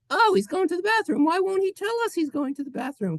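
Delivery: high, fast squeaky chatter; squeaky imagined viewer. Pretend. Imagined viewer gossips about the host's bathroom trip, high and squeaky. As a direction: chirp it up high and quick like a nosy kid.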